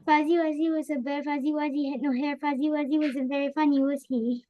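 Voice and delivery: fast tongue-twister reading; as fast as possible. Shown as a demonstration. Pupil reads the rhyme fast for the teacher, rushing. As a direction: race through the rhyme. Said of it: "read this as fast as you can".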